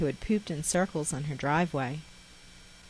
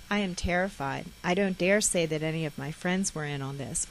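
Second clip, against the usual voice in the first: usual speaking voice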